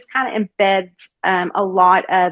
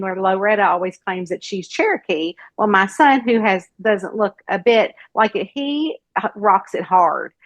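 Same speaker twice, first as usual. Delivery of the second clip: ordinary delivery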